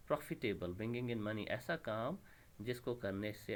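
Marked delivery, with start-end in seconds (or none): none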